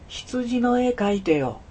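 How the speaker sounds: put-on high, very slow childlike plea; mysterious child's voice. A narrator's reading. Strange boy repeating his request to a startled man, mysterious high voice. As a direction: stretch the request out in a high boy's voice. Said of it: "不思議な声に僕は起こされたのです".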